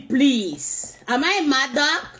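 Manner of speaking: usual speaking voice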